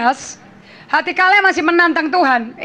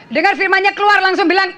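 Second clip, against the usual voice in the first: ordinary delivery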